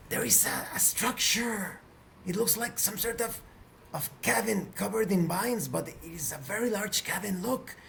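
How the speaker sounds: gasp, then point out the discovery in hushed wonder; startled exclamation. Acted. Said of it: "and exclaims".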